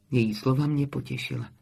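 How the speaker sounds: ordinary delivery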